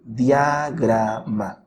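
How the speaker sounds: diagrama very slowly split as dia-gra-ma; syllable by syllable. Shown as a demonstration. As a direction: keep "dia" together in diagrama, pause between syllables. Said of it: "pueden pronunciarse en la misma sílaba".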